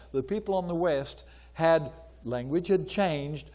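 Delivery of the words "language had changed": ordinary talk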